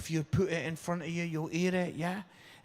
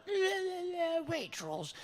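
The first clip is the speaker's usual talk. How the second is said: mock posh yeah yeah Waitrose; posh Tunbridge Wells type; 14 semitones higher, 4 dB quieter than usual. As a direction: send up the snobby shoppers. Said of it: "They're all very posh".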